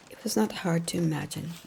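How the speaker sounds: usual speaking voice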